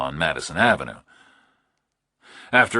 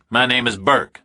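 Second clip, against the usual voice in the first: louder, higher, tense; tense Burke; 7 semitones higher, 5 dB louder than usual. A narrator's reading. Burke answering the kidnapper, tense and louder. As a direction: push through nerves.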